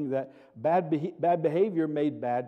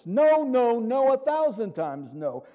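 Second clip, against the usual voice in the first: high, loud, hammered "No, no, no"; emphatic denial; 6 semitones higher, 3 dB louder, slower than usual. Real, not acted. Preacher rejecting outward fixes for sin, emphatic. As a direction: strike down the idea with each "no". Preacher rebuts the imagined reasoning.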